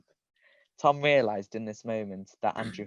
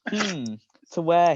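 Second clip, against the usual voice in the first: slow, higher, louder dazed question; a dazed Tom asking; 7 semitones higher, 7 dB louder, slower than usual. A narrator's reading. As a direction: sound lost and foggy. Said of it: "he replied, dazed".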